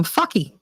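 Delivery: put-on FUCKY, her child's way of saying Sparky; child's mispronunciation. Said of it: "she couldn't say Sparky".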